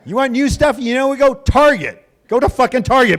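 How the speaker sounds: loud, high comic scolding; exasperated rant. Retold or imitated. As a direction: send them off, fed up. Retold rant at toaster couple.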